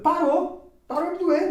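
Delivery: high, loud, emphatic retold answer; amazed confirmation. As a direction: confirm it excitedly, acting out the healed man. Acts the man's amazed answer. (confirmação empolgada, alta e aguda)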